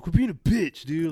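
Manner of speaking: imitating a belligerent drunk jeer; belligerent drunk guy. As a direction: hit it like a pushy drunk. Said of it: "just belligerent and loud".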